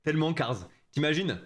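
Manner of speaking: ordinary delivery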